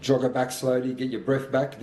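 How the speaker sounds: normal talking voice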